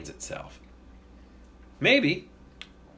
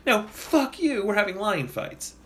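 defiant mock retort; 8 semitones higher than usual. Defiant Roman reply.

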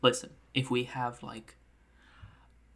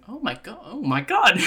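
high, louder on the repeat; panicked outcry; 8 semitones higher, 10 dB louder than usual. Acted. Character at the observation window sees disaster, panicked yelling. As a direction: cry out in mock horror.

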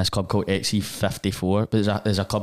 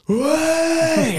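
put-on very high, loud drunken "Way!"; drunken whoop; 4 dB louder than usual. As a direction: shriek it like a wasted brother at a club. Drunk brother yelling at gig.

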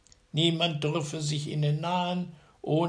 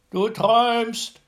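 slow, loud, much higher retort; second giant; 10 semitones higher, 9 dB louder than usual. A narrator's reading. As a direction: wave off the accusation. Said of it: "sagte der andere".

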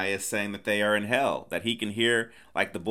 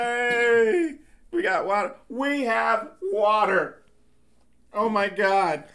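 high, hammed-up celebration; mock cheering; 12 semitones higher, 4 dB louder, slower than usual. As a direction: cheer like a whole thirsty crew, drawn out. Crew cheering at finding water.

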